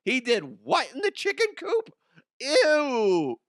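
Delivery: high, loud gossiping voice ending in a squeal of disgust; shrill-pitched mock gossip, yelping ew at the end. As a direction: play a neighbour appalled by the news. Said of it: "people are going".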